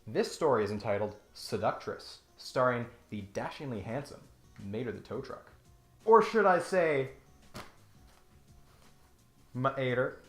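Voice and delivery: ordinary talk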